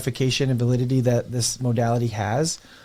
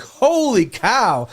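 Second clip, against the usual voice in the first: imitated amazement; 5 semitones higher, 4 dB louder than usual. Yoga teachers after trying breath work, imitated amazement. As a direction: act out a blown-away convert, pitched up.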